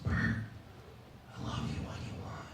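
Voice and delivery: soft, voiceless bedroom whisper; imitated whisper. Girlfriend whispering to Bert in bed, imitated whisper. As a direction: whisper it like a lover in the ear. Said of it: "she whispers in my ear".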